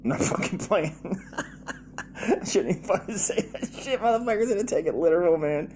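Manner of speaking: high-pitched, talking through real laughter; laughing. Real, not acted. Comedian backpedalling on a risky joke, laughing. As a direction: crack up at your own joke, voice riding up high.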